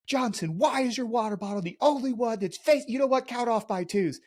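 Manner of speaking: high-pitched mock instructor voice; instructor calling out. Instructor singling out a trainee's water bottle, mock high-pitched callout. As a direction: call it out in a high, put-on voice. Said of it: "the instructor would be walking down".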